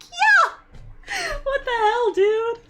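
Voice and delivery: very high, squeaky indignant agreement; mock exasperation. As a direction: act out appalled agreement in a high voice. Acting own reply to dad.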